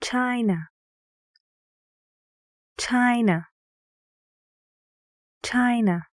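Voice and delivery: "China" with the beat on "Chi", falling on "na"; stressed first syllable. Shown as a demonstration. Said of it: "guess which syllable is stressed".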